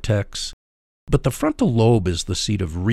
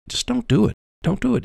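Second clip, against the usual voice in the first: much higher, insistent don't do it; emphatic don't; 13 semitones higher, faster than usual. Shown as a demonstration. Host warning flooded couples not to talk, insistent. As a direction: warn them off, pitched up. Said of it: "emphatic warning".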